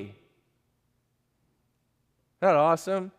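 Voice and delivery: ordinary talk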